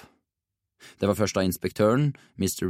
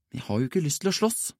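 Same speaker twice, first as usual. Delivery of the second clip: higher, subdued voice of mild despair; despairing mumble; 7 semitones higher, 4 dB quieter than usual. A narrator's reading. Jonathan tells friends he hates fighting, mumbling in despair. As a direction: plead helplessly, pitched up and held back. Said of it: "mumlet han fortvilt".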